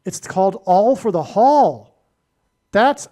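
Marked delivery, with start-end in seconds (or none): 0.6-1.9 "Hall" hit loud and drawn out; emphatic. Real, not acted. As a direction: lean hard on "Hall" as the key word. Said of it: "I say that with emphasis in my voice".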